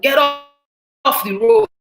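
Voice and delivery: high, loud mock shout; imitated road-rage yell. Retelling a man ordering her off the road, mock shouting. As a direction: call it out like an irritated driver. Driver yelling at her in traffic.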